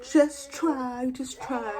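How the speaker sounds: high far mock nurse voice; mimicking the nurse's nagging, high. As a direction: parody her nagging repeat. Nurse mocked in story.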